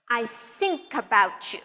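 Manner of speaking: "think" said as "sink", slowly. Shown as a demonstration. Teacher demonstrates a learner's mistake, "think" with an S sound. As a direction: replace the TH in "think" with an S. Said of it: "tempted to say an S sound".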